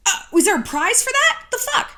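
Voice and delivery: high, loud, incredulous challenge; scornful comeback. Acted. Answering a woman's excuse about being polite, incredulous scorn. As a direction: pitch soars in mock disbelief.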